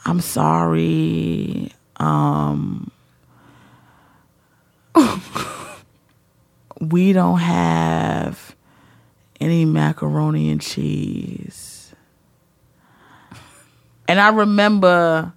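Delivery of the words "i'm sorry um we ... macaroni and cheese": much lower, very soft and slow, a scared waitress; terrified waitress. Retold or imitated. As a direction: barely get it out, dreading the customer's reaction. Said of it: "She's terrified".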